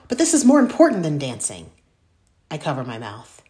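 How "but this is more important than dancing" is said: loud, higher frustrated outburst; blurting out. Acted. Girl blurts out at her best friend, loud and frustrated. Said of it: "I blurt out".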